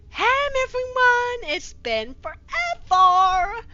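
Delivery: high, loud, slow put-on girly voice; drawn-out high fangirl greeting; mock fangirl voice. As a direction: squeal it like an overexcited teen. Reading fanfic author's note in voice.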